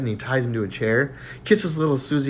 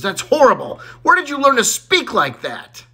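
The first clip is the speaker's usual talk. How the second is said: mildly scandalised teacher, pitched up; scolding teacher; 9 semitones higher than usual. Acted. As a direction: scold a pupil with mock horror. Teacher scolds a swearing pupil.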